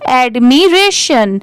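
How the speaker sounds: एडमिरेशन drawn out in separate parts; slow model pronunciation. Shown as a demonstration. Teacher leading learners to repeat, एडमिरेशन slowly syllable by syllable. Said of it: "आप भी मेरे बाद repeat करिए".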